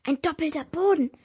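mildly startled exclamation. A narrator's reading. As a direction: let it slip out in shock. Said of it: "entwischte es mir vor Schreck".

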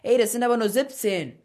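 calling out. A narrator's reading. Boy counting his coins while leaving, calling back. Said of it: "rief aber schließlich".

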